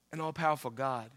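ordinary talk, nothing marked

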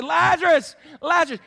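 big, high-pitched shout; shouted summons, high and forceful; loud calling out. Acted. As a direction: call a name out to the dead. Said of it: "He called out in a loud voice".

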